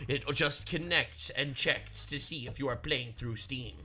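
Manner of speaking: low lightly voiced Pirate Software. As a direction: keep the low imitation going. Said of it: "Doing the pirate voice".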